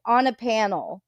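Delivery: ordinary delivery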